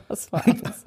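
breathless laughing question; whispered through a laugh. Real, not acted. Laughing at the falling book.

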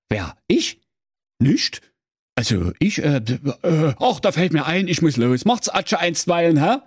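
lightly voiced stammering sexton; caught sexton. Sexton caught in wardrobe stammering excuses, lightly voiced. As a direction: fumble for an excuse, then bolt. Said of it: "Der Küster sprach".

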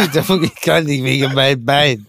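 pseudo-French pizza man voice; mock foreign accent, hammed up; fake French accent. Mocking the pizza man again.

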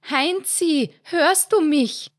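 high, loud, slow anxious call; worried shout. A narrator's reading. Mother cries out to son, high worried shout. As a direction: shout his name in alarm. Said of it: "schrie seine Mutter in Sorge".

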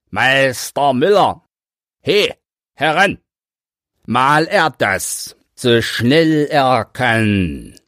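high, carrying call to the miller. Acted. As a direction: shout for the miller to come. Boys call the miller over.

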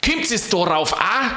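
high, indignant challenge; angry yell. A narrator's reading. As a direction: keep up the scolding, pitched high. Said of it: "schrie er".

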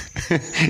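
kurzes, leises Lachen; short laugh. Real, not acted. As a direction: kicher kurz. Laughs at own story. (kurzes Lachen)

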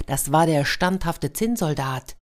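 ordinary delivery, nothing marked

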